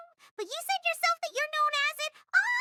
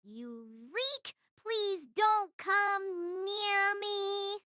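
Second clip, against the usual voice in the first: very slow, stretched-out plea; drawn-out rejection; much slower than usual. Acted. Schoolgirl warding off the stranger, slow and drawn out. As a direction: drag out every word to keep him away. Child rejects him, later rephrased.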